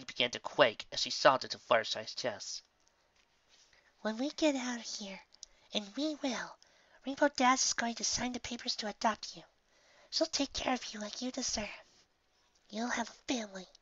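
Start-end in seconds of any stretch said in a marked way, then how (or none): 4.0-13.7 high, soft, soothing voice; soft and tender. A narrator's reading. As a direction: reassure her softly. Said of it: "Fluttershy spoke softly to Scootaloo".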